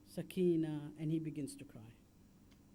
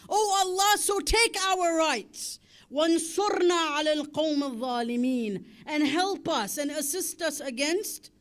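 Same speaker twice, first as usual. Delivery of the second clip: louder, rising preacher's lament, words hammered out; impassioned majlis recital; 5 semitones higher, 7 dB louder than usual. Acted. Preacher retelling the Imam's plea at Karbala, impassioned lament.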